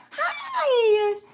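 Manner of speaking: high laughing hello. Real, not acted. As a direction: coo at the kitten. Greeting kitten.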